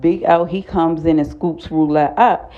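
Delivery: ordinary talk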